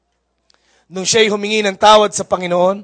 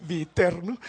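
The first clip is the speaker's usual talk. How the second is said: usual speaking voice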